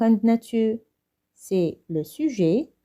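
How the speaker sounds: usual speaking voice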